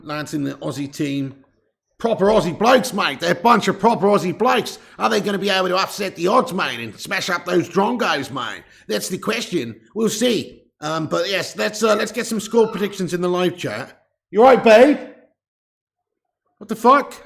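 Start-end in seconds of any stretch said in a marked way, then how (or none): 2.0-8.6 hammed-up Aussie twang, lilting up; broad mock-Australian, rising at phrase ends; put-on Australian accent. Puts on an Aussie voice. 14.3-15.0 loud, high worried call; sudden raised voice, rising with concern. Real, not acted. Calls to partner who screamed off-mic. 16.6-17.3 high, loud startled question; taken aback, rising. Real, not acted. Startled by partner's scream.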